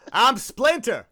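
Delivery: loud, high goofy Splinter voice; hammed-up nasal celebrity bit; Jon Lovitz impression.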